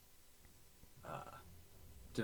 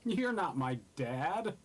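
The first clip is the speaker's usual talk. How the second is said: loud, high, slow petulant retort; sulky teen comeback; 8 semitones higher, 8 dB louder than usual. Acted. Teenage retort in character.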